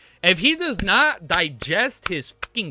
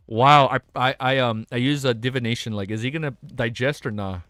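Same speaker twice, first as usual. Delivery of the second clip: ordinary delivery, nothing marked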